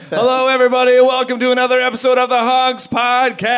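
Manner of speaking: high, bright host welcome; podcast intro. Acted. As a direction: open the show like a host.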